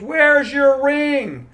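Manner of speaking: high, loud, drawn-out heckle; stretched-out mock heckling shout; girl heckler. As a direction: imitate a girl calling out from the back row. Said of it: "this girl in the back goes".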